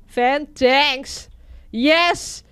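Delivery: ordinary delivery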